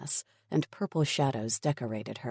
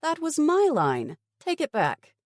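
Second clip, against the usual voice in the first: higher, sharp protest; indignant outburst; 13 semitones higher, 4 dB louder than usual. Acted. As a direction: demand it back, offended. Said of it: "a look of wrath from Dylan".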